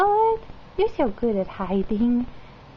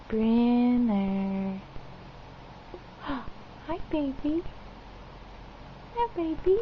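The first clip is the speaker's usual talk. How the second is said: ordinary delivery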